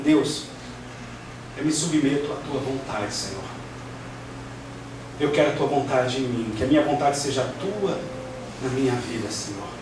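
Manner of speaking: low, subdued prayer of surrender; humble prayer. Acted. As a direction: submit to God softly and humbly. (oração humilde e submissa)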